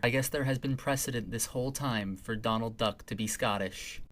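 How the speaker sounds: usual speaking voice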